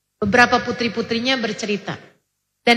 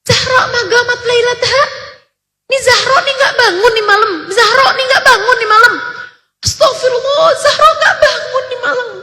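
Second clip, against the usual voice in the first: high, crying wail over a missed night prayer; sobbing lament; 12 semitones higher, 4 dB louder than usual. Retold or imitated. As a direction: weep it out, pitched much higher, breaking with sobs. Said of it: "Beliau nangis terisak-isak".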